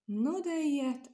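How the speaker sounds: slow, high, amazed exclamation; marveling. A narrator's reading. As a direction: let the wonder stretch the words. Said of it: "álmélkodott az asszonyság". (álmélkodó, lassú felkiáltás)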